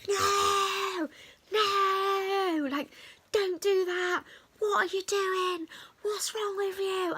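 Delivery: high, shouted mock rant at the learner; imitating a furious yell. Instructor yelling at the learner driver at the roundabout, high mock shout. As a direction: play the instructor losing it, high and shouting, slowing on the last two questions. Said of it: "he just absolutely loses it".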